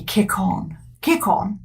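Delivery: lightly posh English rider; clipped upper-class urging a horse. Retold or imitated. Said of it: "in quite a posh voice".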